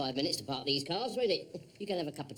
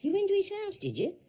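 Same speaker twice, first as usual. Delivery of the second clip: put-on much higher, louder, delighted to a child; coaxing surprise; 6 dB louder than usual. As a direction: sound amazed to keep the kid talking.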